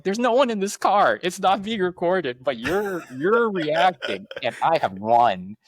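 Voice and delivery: high, drawn-out teasing protest; hammed-up gloating at flustered passengers; mock triumphant retort.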